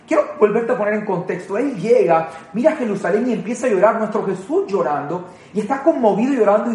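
normal talking voice

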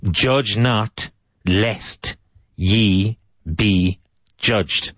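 stretched, grave Bible reading; drawn-out solemn scripture line; slow solemn biblical. Shown as a demonstration. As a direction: intone it like scripture. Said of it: "It's Old English".

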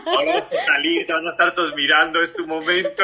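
laughing coach voice; mock pep talk. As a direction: hype the model before the runway, grinning. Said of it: "speech, eh, motivacional".

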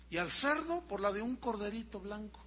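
normal talking voice

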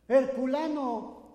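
loud, slow, high call; father calling out proudly; slow shout. Retold or imitated. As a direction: bellow it into the cave.

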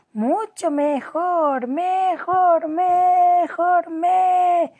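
high, drawn-out cry rising into a sheep's bleat; shrill squeal becoming bleat. A narrator's reading. The Queen turns into a sheep mid-sentence, squealing into a bleat. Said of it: "convertirse en un gritito muy agudo".

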